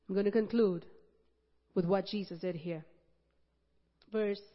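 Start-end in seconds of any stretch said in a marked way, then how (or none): none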